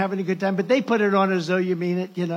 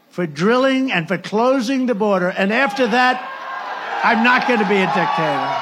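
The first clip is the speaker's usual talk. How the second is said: shouted, higher and louder; loud emphatic shout; 4 semitones higher, 7 dB louder than usual. Retold or imitated. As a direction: belt it to the crowd. Shouting the quote.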